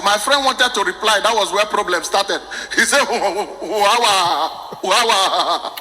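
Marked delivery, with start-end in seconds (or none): 3.1-5.7 drawn-out stammer on the first word; imitated stammerer. Stammering teacher answers the class greeting, imitated stutter. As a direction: get stuck on wawa, then force the words out. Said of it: "My friend happened to be a stammerer".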